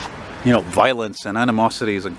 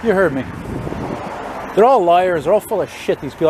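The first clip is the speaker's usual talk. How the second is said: raised, heated voice at politicians; angry rant; 6 semitones higher than usual. Real, not acted. Ranting about crooked politicians.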